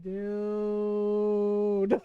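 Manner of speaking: long, low drawn-out "dude"; stunned disbelief. Retold or imitated. Sibling reacting to brother's blunder, stunned and low. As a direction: stretch it out low in appalled disbelief.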